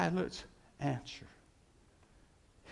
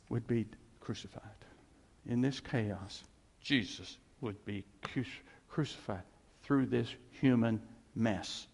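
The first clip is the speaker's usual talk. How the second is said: ordinary talk, nothing marked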